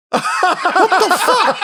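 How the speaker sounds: high, breaking into laughter; what the fuck through laughter; laughing disbelief. Real, not acted.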